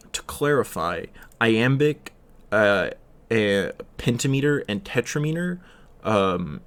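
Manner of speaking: ordinary talk, nothing marked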